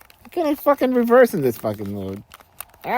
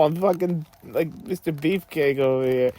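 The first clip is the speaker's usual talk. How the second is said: usual speaking voice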